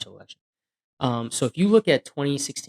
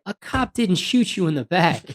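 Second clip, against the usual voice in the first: slow, raised, angry correction; retold anger; 6 semitones higher, 3 dB louder than usual. Cop to the man who blamed police, slow angry retort. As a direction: lay the truth on him, hard and deliberate. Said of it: "I got so mad".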